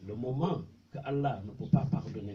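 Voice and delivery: ordinary talk, nothing marked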